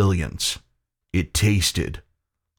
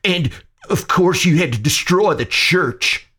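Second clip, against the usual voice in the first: tense, high-pitched angry retort; hissed accusation; 10 semitones higher, 3 dB louder than usual. A narrator's reading. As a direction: hiss it as a bitter accusation. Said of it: "McCreary hissed".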